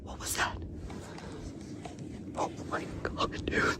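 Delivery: very soft unvoiced whisper; breathless frightened whisper; terrified whisper. Real, not acted. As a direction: whisper so it can't hear. Hears something in dark.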